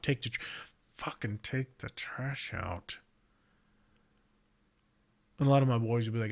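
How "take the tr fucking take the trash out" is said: slow, softer fed-up repeat; exasperated nag. Retold or imitated. Partner nagging about the trash, slow and fed up. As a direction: drag it out, worn down.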